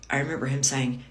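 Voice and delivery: ordinary talk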